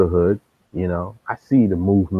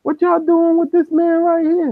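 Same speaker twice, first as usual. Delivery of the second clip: worked-up defense. Retold or imitated. Brother defends him to crew.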